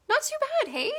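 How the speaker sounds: a Canadian "hey?" lifted high at the end; a cheery high Canadian tag; Canadian accent. Real, not acted. Showing off the painted bird to viewers in a high, bright Canadian accent. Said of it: "There's my Canadian accent coming out".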